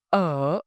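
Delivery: Uh said from the throat, lips relaxed and open; an open unrounded Uh from the throat; vowel demo. Shown as a demonstration. Teacher isolates the vowel for learners, relaxed and open. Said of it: "move on to the vowel".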